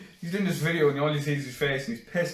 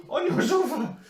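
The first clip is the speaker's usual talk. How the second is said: mock polite caller; 13 semitones higher than usual. Teasing friend about entering a house, high mock caller voice. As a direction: call out like a hopeful night visitor.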